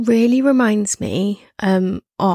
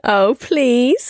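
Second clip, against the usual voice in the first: higher-pitched, coaxing plea; playful pleading; 4 semitones higher than usual. Pretend. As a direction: beg your co-host in mock pleading. Begging co-host for the accent.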